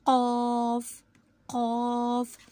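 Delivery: कौफ hit hard from the tongue root; heavy letter demo. Shown as a demonstration. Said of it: "कौ की sound निकलेगी".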